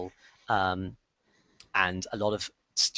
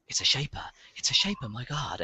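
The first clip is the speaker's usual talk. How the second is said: hushed, awed whispering in the crowd; murmured whisper; 4 semitones higher than usual. Acted. Crowd members whispering to each other in awe. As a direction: breathe it like a rumor passing through. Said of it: "you can hear kind of a murmur".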